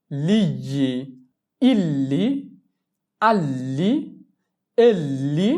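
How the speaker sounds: slow, separate drilled syllables. Shown as a demonstration. Teacher drills Italian gli syllables, one by one. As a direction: model each syllable for repetition. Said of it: "তুমি এটার উচ্চারণটা করবা".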